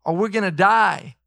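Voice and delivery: high, pressing cry to Jesus; panicked disciples begging Jesus; desperate pleading. Retold or imitated. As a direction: beg for rescue as the boat sinks. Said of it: "They were saying".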